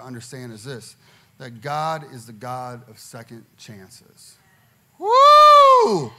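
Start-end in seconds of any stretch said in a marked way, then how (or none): 5.0-6.1 high, loud whoop; excited whoop. Real, not acted. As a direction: let out a big whoop. Pastor whoops to congregation.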